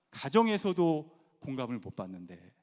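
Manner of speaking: ordinary delivery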